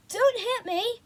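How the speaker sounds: high panicked cry; frightened shout. A narrator's reading. Boy shouting at the wild figure with a stick, high scared cry. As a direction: yelp it out in fright. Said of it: "I shouted".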